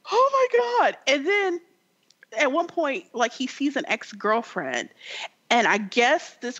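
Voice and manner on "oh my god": very high mock-shocked woman. Game woman reacting to naked men cards, mock shocked. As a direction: imitate her with a high-pitched exclamation.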